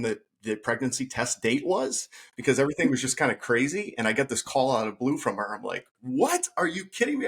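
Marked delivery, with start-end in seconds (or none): 6.0-7.3 high-pitched, delighted shock retold; squeaky-high incredulous joy; ecstatic disbelief. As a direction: re-enact the stunned happy reaction, pitch leaping up. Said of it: "I was, like, so, so, like, ecstatic".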